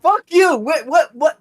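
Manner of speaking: mock furious outburst; high angry shout. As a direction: yell it high and loud. Mock fury at friends.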